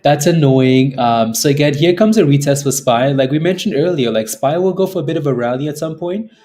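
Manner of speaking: loud, pushing hard; loud cocky finance bro. Real, not acted. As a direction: say it big and loud. Loud streamer rant.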